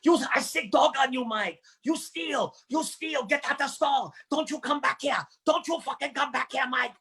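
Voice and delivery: lightly put-on Korean accent, chasing him out; Korean shopkeeper. Korean shopkeeper throwing Mike out of the store, mock Korean accent.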